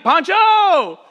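loud high shout across an airport; delighted shout of recognition; calling out. Retold or imitated. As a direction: yell the name with a laugh.

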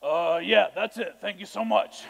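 lightly deepen the voice to sound like a man. Shown as a demonstration. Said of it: "I deepen my voice".

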